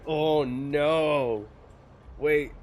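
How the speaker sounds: usual speaking voice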